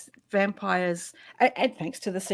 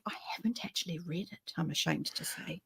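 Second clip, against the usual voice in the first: sheepish whisper; 2 semitones lower, 13 dB quieter than usual. Real, not acted. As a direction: whisper it like a confession. Confessing an unread book.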